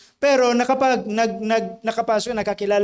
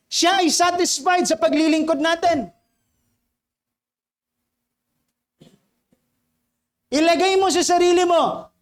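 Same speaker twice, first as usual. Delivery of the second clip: raised, ringing sermon voice; preaching; 7 semitones higher, 3 dB louder than usual. Acted. Pastor challenging the congregation, preaching at full force. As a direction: drive the question home from the pulpit.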